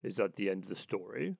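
low questioning animal character; low-pitched rat, question lifting at the end; voiced Water Rat. Water Rat's dialogue in story.

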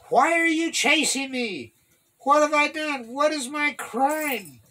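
aggrieved questions, pitched high; pleading protest. A narrator's reading. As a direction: protest your innocence to the king. David pleads with the pursuing king.

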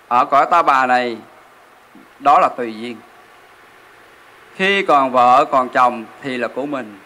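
ordinary talk

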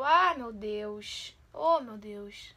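drawn-out despair. Real, not acted. Panicking at hard question.

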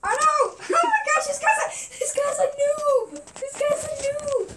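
high, loud laughing yell; laughing panic shout. Real, not acted. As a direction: shriek it while laughing. Found by seeker, laughing panic.

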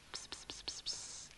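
whispered secret into an ear; whispering. A narrator's reading. Bastoncello whispering into the ant's ear, whisper. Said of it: "gli sussurrò qualcosa".

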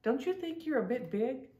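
lightly voiced fairy, teasing; a hint of an impish fairy. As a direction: tease her like a cheeky fairy. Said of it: "Fawn raised her eyebrows".

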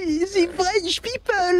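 high, very slow mock French accent. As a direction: put on a drawn-out squeaky French accent. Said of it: "can you do the Frenchman's accent".

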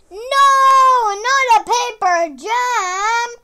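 loud, high wail, half sung; anguished cry. Acted. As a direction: cry out in hammed-up despair. Paper cries out in dismay.

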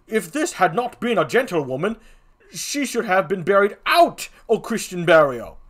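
lightly voiced comic gravedigger; a hint of a rustic Shakespeare figure; second clown. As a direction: answer firmly as the other clown.

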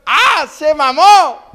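loud, high, drawn-out jeer; acted collective holler; crowd outcry. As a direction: exclaim like a whole planeload reacting. Whole plane reacts aloud.

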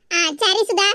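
usual speaking voice

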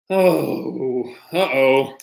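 plain reading voice

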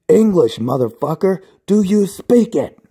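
high shrieking mock yell; hammed-up movie shout; Samuel L. Jackson yell. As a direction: screech it in his face. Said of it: "Samuel L. Jackson yelled in my face".

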